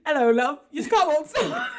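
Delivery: a chirpy British car cheerily warning. Pretend. The car's chirpy British voice calls out to its driver. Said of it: "It might just say".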